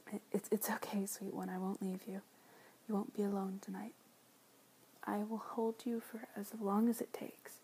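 normal talking voice

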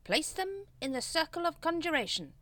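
high-pitched ceremonial order; commanding Grand Master. A narrator's reading. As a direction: intone the instruction as cult leader.

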